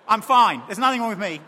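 loud, defensive snap; the bottler denies being angry, loudly; angry denial. Acted. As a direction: insist loudly that nothing's wrong. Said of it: "acted angry denial".

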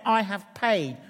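loud, high proclamation; crying out. Retold or imitated. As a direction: cry it out from full lungs. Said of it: "and cried out".

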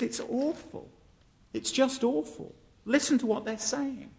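mildly acted distress, no real crying; tearful child. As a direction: complain to father like an upset child. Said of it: "comes home in tears".

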